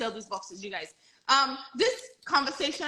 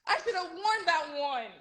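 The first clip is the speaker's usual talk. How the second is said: ordinary delivery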